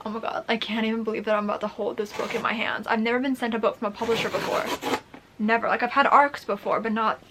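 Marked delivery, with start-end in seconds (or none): none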